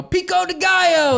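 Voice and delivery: very high, loud playful yell; yelled greeting. Retold or imitated. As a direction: holler down the steps in greeting. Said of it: "I'd yell".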